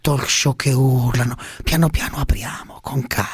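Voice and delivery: measured politician parody sinking to a whisper; Prodi. Acted. Parody statesman against shouting talk shows, hushed to a whisper. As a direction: plead for calm, ending in a breathy whisper. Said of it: "scusi, Prodi".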